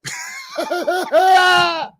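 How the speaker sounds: long high-pitched laughing fit; helpless high cackle. Real, not acted. Streamer losing it after his shouted bit, long high laugh.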